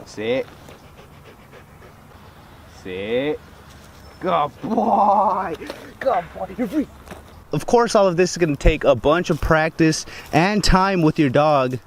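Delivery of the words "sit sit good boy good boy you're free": talking to a dog. Real, not acted. Commands and praise to dog.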